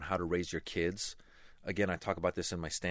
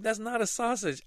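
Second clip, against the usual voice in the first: put-on retorting kids; 14 semitones higher, 3 dB louder than usual. Classmates retort.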